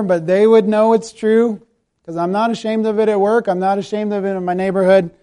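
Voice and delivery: usual speaking voice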